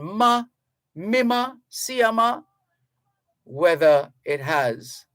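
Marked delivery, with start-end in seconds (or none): 0.0-2.4 high-pitched Jamaican exclamation. Retold or imitated. Host repeating his mother's Jamaican exclamation, high-pitched. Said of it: "that Jamaican expression".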